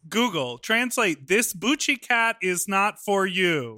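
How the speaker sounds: slow, high-pitched mock command to an app; mock translator dictation. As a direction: dictate it slowly and emphatically, as if to Google.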